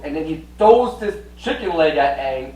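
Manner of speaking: ordinary delivery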